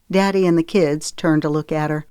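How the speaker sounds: ordinary delivery, nothing marked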